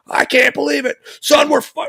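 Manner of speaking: high, excited retelling of dad's cheering; imitating dad's happy yelling. Father calling his son after the court news, excitedly yelling. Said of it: "he's yelling, but not in a bad way".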